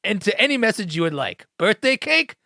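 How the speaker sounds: high-pitched, hammed-up commercial pitch; playful salesman spiel, lifted and punchy; mock ad pitchman. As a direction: sell it like a cheesy company advert.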